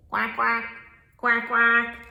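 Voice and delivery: short mock quacks; duck quacking. Storyteller answering what the duck says, duck quacks. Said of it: "what does that duck say?".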